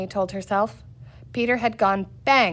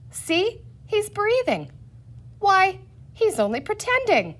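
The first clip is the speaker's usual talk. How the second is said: lightly voiced girl; 10 semitones higher than usual. A narrator's reading. Pamela voiced higher.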